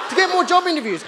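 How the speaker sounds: ordinary delivery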